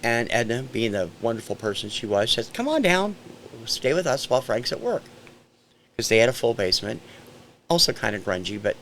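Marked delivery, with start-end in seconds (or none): 2.5-5.0 higher-pitched, inviting call; lightly voiced friendly landlady. Landlady inviting the family to stay, lightly imitated higher voice. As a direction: lightly imitate a neighbor inviting you over.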